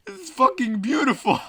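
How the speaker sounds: real amused delight. Real, not acted. Reader reacts to the mutiny parade, cracking up.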